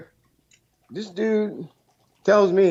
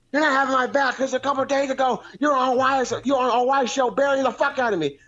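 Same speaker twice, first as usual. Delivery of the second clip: much higher mock rant at a buddy; imitating angry friend; 11 semitones higher than usual. Caller imitating his friend Bullfrog telling him off, high mock rant. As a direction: whine out his friend's furious brush-off, pitched way up.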